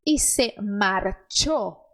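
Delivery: slow, clear dictation pace; slow model reading. Shown as a demonstration. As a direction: read it slowly for the learner.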